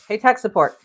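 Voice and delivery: loud call to someone nearby. Real, not acted. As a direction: call out across the house. Calling helper from another room.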